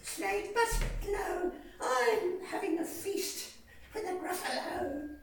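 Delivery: lightly voiced high mouse declining sweetly; polite little mouse. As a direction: mouse turning down the snake. Mouse answering snake.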